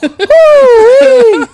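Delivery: high, loud pained squeal; ooh-wee. Retold or imitated. Remembering the yanked strap, high loud squeal. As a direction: squeal at the memory of the pain. Recalling the painful yank.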